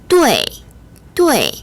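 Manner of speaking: 对 said alone, high falling tone; pronunciation model of 对. Shown as a demonstration. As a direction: model 对 firmly with a sharp fall from high. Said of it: "요 부분 발음 다시 한번 볼게요".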